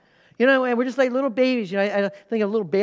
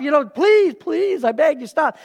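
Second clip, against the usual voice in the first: mock begging; 6 semitones higher, 5 dB louder than usual. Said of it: "It's not you yelling at, at, at the storm".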